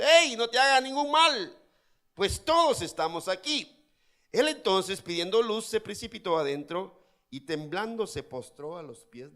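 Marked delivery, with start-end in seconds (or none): 0.0-3.8 Pablo calling out high and loud; loud cry. Acted. Pablo shouting to the jailer about to kill himself, loud cry. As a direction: shout across the prison to stop him. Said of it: "Pablo clamó a gran voz".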